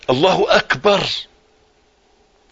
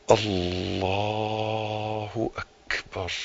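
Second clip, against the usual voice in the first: very slow, soft, stretched Allahu akbar; drawn-out takbir; 6 semitones lower, 13 dB quieter, much slower than usual. Shown as a demonstration. Preacher demonstrating the opening takbir, slow and stretched. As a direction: stretch الله اكبر out long and low. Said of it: "رفع ايديك الاستسلام بقى".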